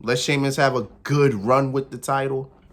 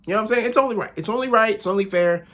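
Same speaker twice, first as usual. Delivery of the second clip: smug emphasis; 9 semitones higher, faster than usual. Acted. Pleased with his own pick.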